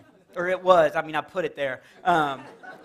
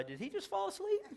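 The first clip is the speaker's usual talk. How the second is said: puzzled God; 12 semitones higher, 12 dB quieter than usual. Retold or imitated. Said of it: "God was like".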